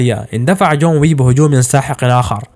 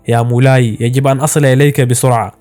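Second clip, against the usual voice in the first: ordinary delivery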